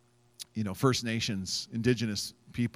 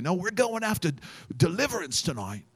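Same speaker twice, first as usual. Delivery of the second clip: lightly imitated intense Australian preacher; a mild, earnest Australian preacher impression; 7 semitones higher than usual. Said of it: "this guy was intense".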